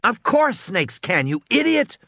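put-on high girl calling her brother an idiot; scornful girl. A narrator's reading. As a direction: sneer it like a bossy kid sister. Lex insulting her brother.

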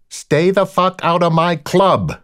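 slow, high-pitched parting shout; angry shout. A narrator's reading. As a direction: shout a last warning after them, word by word. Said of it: "Kukaroff shouted".

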